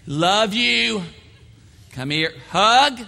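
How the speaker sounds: John greeting a friend with loud gushing affection; loving John. Acted. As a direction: gush like an overeager hugger. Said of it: "John was a loving".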